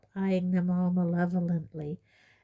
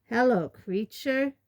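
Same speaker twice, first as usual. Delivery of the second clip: a loud, pointed hello, higher; loud greeting; 5 semitones higher, 4 dB louder than usual. A narrator's reading. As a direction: call out over the muttering. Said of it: "said Fred very loudly".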